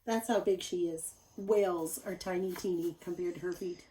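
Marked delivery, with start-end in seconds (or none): none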